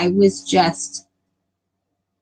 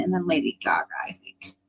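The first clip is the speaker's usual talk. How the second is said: whispered aside; 3 semitones lower, 8 dB quieter than usual. Real, not acted. Streamer muttering the remake list to herself, whispering. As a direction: drop to an unvoiced whisper.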